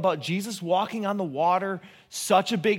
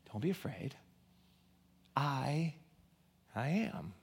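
hushed whisper; 4 semitones lower, 13 dB quieter than usual. Acted. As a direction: breathe Jesus's words in a whisper. Jesus's words whispered.

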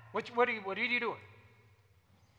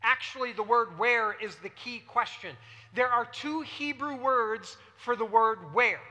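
usual speaking voice

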